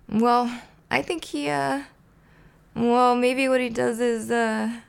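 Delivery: high, halting, stuck for an answer; hesitant. Acted. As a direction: stall with drawn hesitations.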